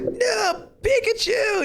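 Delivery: very high desperate cry; mock anguished cry. Ash crying out as Pikachu gets sucked up, very high cry. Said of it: "Ash is like".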